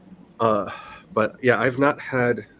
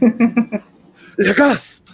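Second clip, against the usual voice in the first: high, hammed-up French exclamation; mock French gasp; 10 semitones higher, 4 dB louder than usual. Streamer showing off her best French gasp, mock French. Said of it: "It was the best French gasp".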